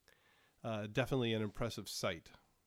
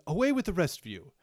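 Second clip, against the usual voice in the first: louder, higher, quick dismissal; dismissive command; 7 semitones higher, 7 dB louder, faster than usual. Acted. Monarch dismissing the courtiers, curt and raised. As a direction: wave them off sharply.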